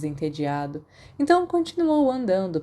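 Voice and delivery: plain reading voice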